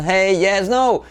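"hey", "yes", "no" as a melodic trio; intonation technique. Shown as a demonstration. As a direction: lift "yes" high, then drop on "no". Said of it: "nuestra técnica de entonación".

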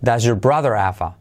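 "have a" as ava, linked onto "brother"; does-yuh-brother-ava; dropped H. Shown as a demonstration. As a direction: drop the H of "have a". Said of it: "la H se de-, se cae también".

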